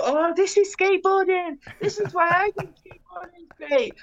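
high, mocking know-it-all voice; mocking preachy skater. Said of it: "they're like".

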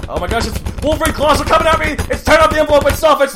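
high, loud, frantic and fast; excited shout. Acted. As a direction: yell like claws are attacking you. Mock awards envelope reveal.

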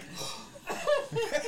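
high, breathy laughing gasp; laughing mock gasp. Shocked at discovery, laughing.

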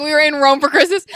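loud, high mock screaming. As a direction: shout it like a scandalized fan.

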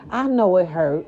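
ordinary talk

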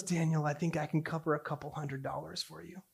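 very soft, understated answer; calm fatherly reply. Retold or imitated. Dad offering his son money, calm and very quiet. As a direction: answer quietly, without any fuss.

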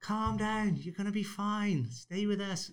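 put-on high, gentle old lady reassurance; soothing old ladies. Old ladies calm the drowning dad, high and soothing. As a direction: coax a panicking swimmer like a kindly gran. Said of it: "And they're like".

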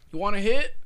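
high, loud mock offer; offering a hit. Imitating the driver offering weed, high mock voice. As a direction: toss it out like a stoner. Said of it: "And he goes".

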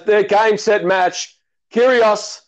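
mock TV tennis commentary; play-by-play commentary. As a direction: call the point like a TV commentator. Match-winning call.

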